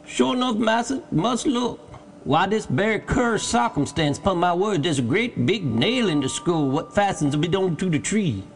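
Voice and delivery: high-pitched dialect voice, wondering; Jupiter, puzzled servant. A narrator's reading. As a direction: report what you find as you look. Jupiter examines the skull.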